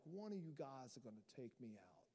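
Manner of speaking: low, hushed mob boss impression; mafia boss. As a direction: do the Godfather, low and soft. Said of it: "like some mafia boss sitting there".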